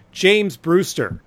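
ordinary talk, nothing marked

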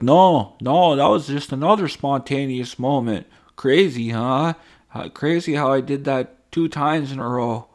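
high, loud mock-innocent protest; fake innocent. Influencer denying staged videos, high mock innocence. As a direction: insist it was spontaneous, hammed up. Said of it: "And then you're like".

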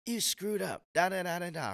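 high-pitched mock telling-off; mock scolding. Leader shown how not to correct someone, mock scolding. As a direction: imitate a blunt, harsh call-out. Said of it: "It wasn't like".

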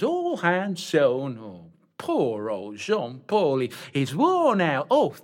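flounce it like a panto dame; camp dame voice. Acted.

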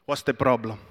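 low, menacing calm challenge; tough father. Retold or imitated. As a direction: square up to the gang, voice low. Father confronting gang at gate.

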